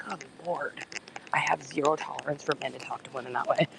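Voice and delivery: hushed, unvoiced, indignant aside; whispered disgust. Real, not acted. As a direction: whisper your outrage to the viewers.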